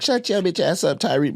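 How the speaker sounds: pitched high mock put-down; mock harsh scold. Co-host expects him to mock Tyreek, so a high mock scold. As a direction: pretend to snap at Tyreek. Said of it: "you want me to be like".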